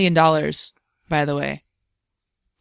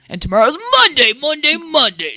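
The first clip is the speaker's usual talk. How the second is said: announcer voice; 7 semitones higher, 5 dB louder than usual. Pretend. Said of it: "even when you say it like that".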